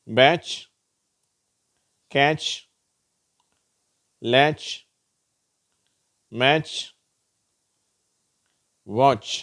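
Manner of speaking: "பேட்ச், கேட்ச், லேட்ச், மேட்ச், வாட்ச்" ending in a crisp tch; TCH pronunciation. Shown as a demonstration. Teacher reading out examples, "பேட்ச், கேட்ச், லேட்ச், மேட்ச், வாட்ச்" ending in tch. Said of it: "டி சி ஹெச் சேரும்போது எப்படி உச்சரிக்கப்படுகிறது".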